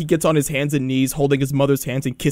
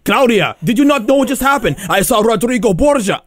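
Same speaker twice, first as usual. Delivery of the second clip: higher, urgent Ezio retelling; agitated storytelling; 4 semitones higher, 4 dB louder than usual. Acted. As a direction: burst in with big news, hammed-up. Acting out Ezio telling Claudia.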